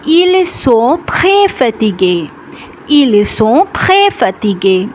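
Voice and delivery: slow, carefully spaced French; slow model sentence. Shown as a demonstration. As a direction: spell it out slowly for learners.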